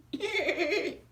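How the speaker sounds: high-pitched jolly chuckle; high jolly laugh. Acted. Santa laughing at his dolphin joke, high and jolly. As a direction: laugh at your own joke.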